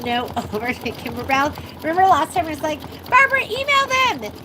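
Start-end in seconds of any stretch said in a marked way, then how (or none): 3.1-4.3 high-pitched mock order to Barbara; playful high-pitched holler; mock calling out. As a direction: call it out high, as if urging her on. Retells calling on Barbara to act.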